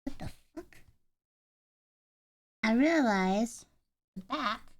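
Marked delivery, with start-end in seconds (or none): 0.0-0.8 breathless, high unvoiced disbelief; stunned whisper at the shelf; whispered surprise. Real, not acted. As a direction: hiss it out in shock. Sudden confusion over the shelf.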